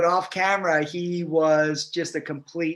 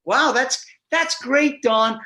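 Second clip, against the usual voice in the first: high, loud, overdone congratulation; mock praise, pitched up and pushed hard; patronizing; 7 semitones higher, 4 dB louder, slower than usual. Drama students congratulating the carpenter, patronizing. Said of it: "patronizing him".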